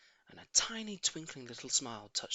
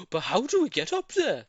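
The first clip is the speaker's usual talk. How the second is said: monkeys asking; 9 semitones higher, 7 dB louder than usual. Acted. Monkeys ask how to climb.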